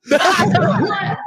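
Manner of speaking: a shrill-pitched mock "What?", way up high; high startled what. Doctor retelling his patient's shock, high surprised yelp. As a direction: play the stunned patient yelping in disbelief.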